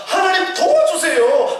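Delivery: drawn-out plea, pitched high; slow pleading cry. Pretend. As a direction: beg God slowly for help. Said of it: "부르짖으면서".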